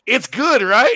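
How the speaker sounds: loud, high, over-eager pitch; hammed-up cheery insistence; mock salesmanship. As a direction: push it on them with fake enthusiasm. Imitates studio pushing bad product.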